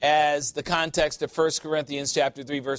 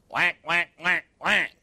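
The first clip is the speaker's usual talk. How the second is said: mock duck quacking; duck quacks; 2 semitones higher than usual. Preacher mimicking worldly talk as a duck, quacking. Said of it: "to talk like a duck".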